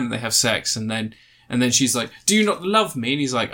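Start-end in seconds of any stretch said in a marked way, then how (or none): 2.3-3.1 put-on high, pleading English accent. Alien's wife asks if he loves her, high pleading English accent. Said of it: "doing an English accent".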